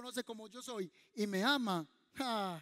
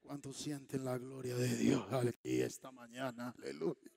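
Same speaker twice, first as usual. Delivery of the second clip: much lower, deep booming pastor voice; parodying a pastor, very deep and solemn; mock preacher; 11 semitones lower than usual. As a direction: intone like a theatrical pastor. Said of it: "el pastor se levanta y dice".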